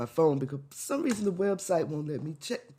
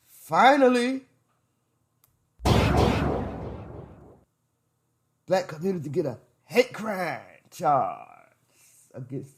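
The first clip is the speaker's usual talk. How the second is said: sarcastic; 4 dB louder than usual. Real, not acted. Sarcastic mock celebration.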